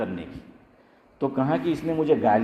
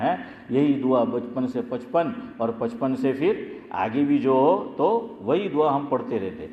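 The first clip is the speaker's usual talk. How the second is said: normal talking voice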